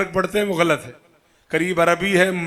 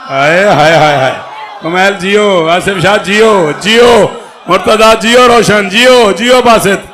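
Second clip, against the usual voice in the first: shouting praise; 9 dB louder than usual. Real, not acted. Preacher loudly blessing the listeners who cheered him. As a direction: bless each listener aloud.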